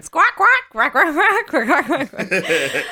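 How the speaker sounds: high, loud quacking with laughter; bird squawking. Retold or imitated. Player imitates what Zach really sounds like, laughing bird squawks. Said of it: "Zach's just going".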